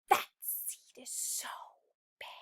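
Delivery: hushed whispered gossip. Acted. Other seeds whispering about the bad seed, hushed. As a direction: whisper it behind his back. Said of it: "Mumble's like a whisper under your breath".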